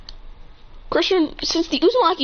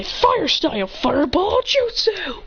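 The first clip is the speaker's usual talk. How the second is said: high, drawn-out battle cry; dramatic anime-style technique shout; attack call-out; 7 semitones higher than usual. Acted. Character calls out an attack.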